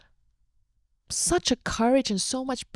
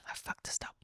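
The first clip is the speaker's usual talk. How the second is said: whisper; 17 dB quieter than usual. Retold or imitated. Voicing father's inner regret.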